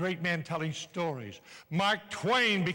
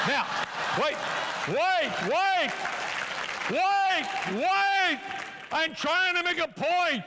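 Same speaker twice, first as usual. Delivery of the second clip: calling over laughter; 10 semitones higher, slower than usual. Real, not acted. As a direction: shout over the crowd to regain order.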